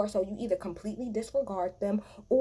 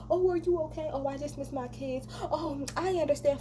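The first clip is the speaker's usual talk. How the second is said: imitating the cast's sugary concern, pitched up; mock them with sing-song false pity; mocking fake sympathy; 6 semitones higher than usual. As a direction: fake caring coo. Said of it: "Fuck, y'all are all fake".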